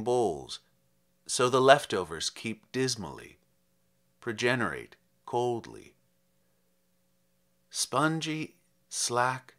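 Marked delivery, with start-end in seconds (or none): none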